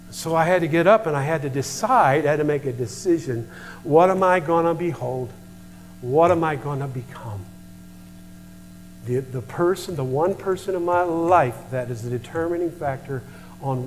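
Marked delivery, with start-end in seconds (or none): none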